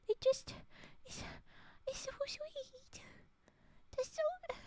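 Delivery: high, slow, whispered gush. Real, not acted. Streamer adores the emote, slow high whisper. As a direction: melt over it in a whisper.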